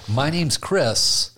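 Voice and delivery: slow deliberate self-introduction; slow intro. Pretend. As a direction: draw out the name slowly. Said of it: "After you say".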